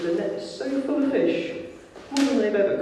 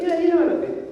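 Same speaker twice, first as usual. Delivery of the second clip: a much higher, louder hurt reply; high, hurt Peter; 13 semitones higher, 6 dB louder, faster than usual. Retold or imitated. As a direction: plead it up high, a bit wounded. Peter answers Jesus, retold.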